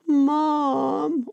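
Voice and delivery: put-on high, long-held whiny child's call; drawn-out whine. Parenting host mimicking a child calling a parent, drawn-out whine. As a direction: imitate a child dragging out a whine. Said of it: "a bit of whining on your parenting journey. The drawn out".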